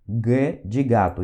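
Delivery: G de gato said slowly and deliberately; slow spelling-out. Shown as a demonstration. Teacher slowly spells out G de gato for learners. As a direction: drag out G de gato. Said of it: "Letra G, por exemplo".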